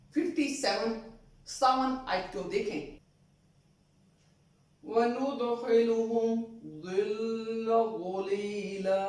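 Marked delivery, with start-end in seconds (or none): 4.8-9.0 slow chanted tajweed recitation; measured Quran chant; Quranic recitation. Shown as a demonstration. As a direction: recite the verse slowly, melodically.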